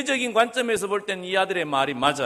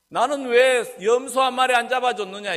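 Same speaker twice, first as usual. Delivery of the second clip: higher, louder protest voicing the aggrieved brother; indignant complaint; 6 semitones higher, 5 dB louder than usual. Elder brother complains to father about the goat, indignant. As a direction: protest like a slighted elder son.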